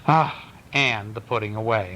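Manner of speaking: ordinary delivery, nothing marked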